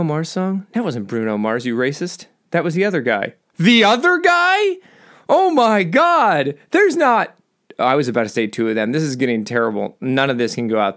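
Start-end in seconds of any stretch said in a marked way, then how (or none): 3.6-7.4 high, loud, slow gasp of disbelief; horrified surprise. Real, not acted. Host horrified at his own slip, high loud gasp. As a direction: catch yourself in an awful blunder.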